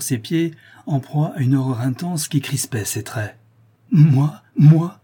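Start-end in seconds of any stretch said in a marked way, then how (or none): 3.9-5.0 loud, drawn-out appalled "moi"; stammered horror. A narrator's reading. Accused Marion stammers back at Maurice, stammering in horror. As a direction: blurt it loudly, aghast and halting. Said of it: "balbutiait-il".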